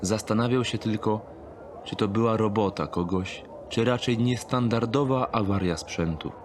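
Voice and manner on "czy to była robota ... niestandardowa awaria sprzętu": usual speaking voice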